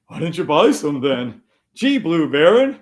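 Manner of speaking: jeering mimicry that climbs high and loud; mocking imitation. Streamer throwing a heckler's question back, loudly mocking.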